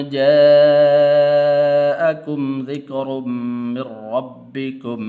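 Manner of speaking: melodic chanted recitation; Quran recitation. Real, not acted. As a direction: chant each verse slowly with melody. Teacher recites Quran verses.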